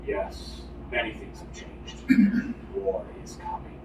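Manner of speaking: a hint of a low, hushed war chief; lightly voiced stern father, grave and quiet; grim father. Chieftain father to his returning son, hushed and grave.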